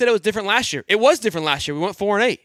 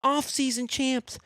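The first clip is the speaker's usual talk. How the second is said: mocking haters; 3 semitones higher, 9 dB quieter, much slower than usual. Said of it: "You're gonna see the haters in the comments".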